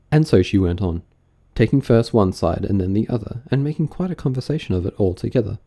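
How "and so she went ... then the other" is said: ordinary delivery, nothing marked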